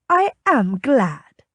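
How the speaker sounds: drawn-out, pleased delivery. A narrator's reading. Lady glad the captain is her friend, pleased. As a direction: draw it out with light delight. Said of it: "giving a little bounce".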